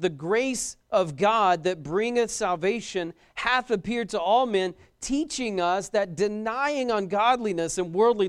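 slow, weighty scripture reading. Acted. Preacher reading Titus to the congregation, slow and weighty. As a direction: read the verse out with gravity.

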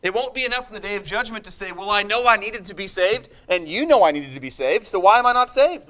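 high, loud, fiery sermon climax; loud, high, impassioned pulpit voice; full-force preaching. Acted. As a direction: preach it out with rising passion.

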